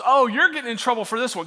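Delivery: gloating little brother. Retold or imitated. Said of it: "all he could say was".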